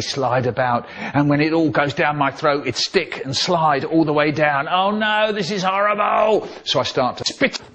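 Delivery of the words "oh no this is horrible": high-pitched cry of dismay; mock dismay. Child choking down a hated mouthful, dismayed. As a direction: wail it higher, in comic horror.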